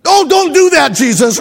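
high, loud mock protest; mock pleading. Preacher playing a man refusing healing, hammed-up plea. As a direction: whine like a scandalized objector. Man begs Jesus not heal.

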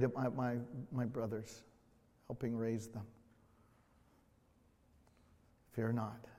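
soft, low voice with long pauses; quiet, solemn pulpit reflection; hushed reflective preaching. Acted. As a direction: let each phrase settle gently in the quiet.

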